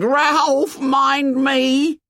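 very high, loud shriek; drawn-out, frightened high scream; terrified scream. A narrator's reading. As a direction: scream for help in panic. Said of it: "Piggy screamed".

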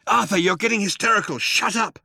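high, quick scolding; exasperated. A narrator's reading. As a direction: scold the panicker into silence. Ford tries to silence Arthur.

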